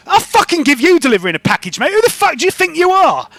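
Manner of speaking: loud, high, heated threat; angry shopkeeper. Acted. Shopkeeper threatening the delivery driver, furious.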